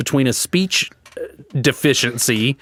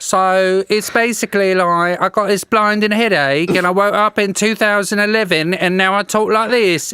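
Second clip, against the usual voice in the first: put-on [name] British accent; 6 semitones higher than usual. Retelling the beauty queen's clip, mock British accent. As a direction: imitate the woman in a chirpy British accent. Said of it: "Like a [name]".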